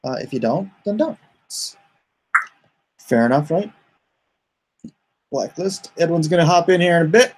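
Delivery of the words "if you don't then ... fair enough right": usual speaking voice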